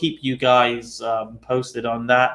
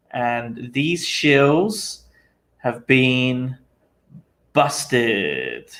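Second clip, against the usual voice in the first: slow, drawn-out sign-off with suspenseful pauses; dramatic catchphrase; much slower than usual. Pretend.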